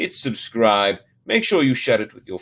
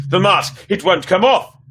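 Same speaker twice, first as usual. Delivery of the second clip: high, loud panicked alarm; a girl finds the mask stuck on her face, loud panicked alarm; panicked cry; 9 semitones higher, 7 dB louder than usual. A narrator's reading. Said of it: "she cried".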